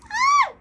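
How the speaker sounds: sharp high squeal; sudden squeal. Real, not acted. Vlogger tasting the lip scrub, sudden high squeal. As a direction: let out a burst of a squeal.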